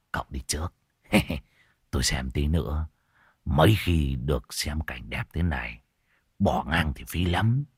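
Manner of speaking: low, soft voice, leaning in; low confidential old man. A narrator's reading. Old man confiding to the young man, low and soft. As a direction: murmur a knowing warning.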